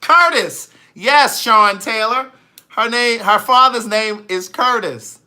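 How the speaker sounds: loud, high, triumphant reveal; emphatic confirmation. Real, not acted. Confirming a viewer's guess of the father's name, loudly emphatic. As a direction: belt it out as the right answer.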